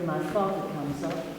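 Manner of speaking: ordinary delivery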